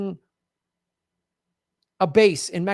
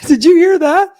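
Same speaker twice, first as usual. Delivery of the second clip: bursting out laughing, much louder and higher; 10 semitones higher, 11 dB louder than usual. Real, not acted. Host laughing at the guest's sharp remark. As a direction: laugh it out, delighted by the point.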